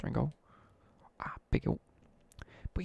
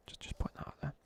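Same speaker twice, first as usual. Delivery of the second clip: whispered aside; 8 dB quieter, faster than usual. Real, not acted. Aside about dating the girl.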